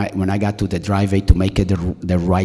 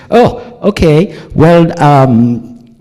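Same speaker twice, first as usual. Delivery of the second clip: high, loud, drawn-out hesitation; mock taken-aback nurse; 7 semitones higher, 9 dB louder, much slower than usual. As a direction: imitate a flustered nurse stalling for words.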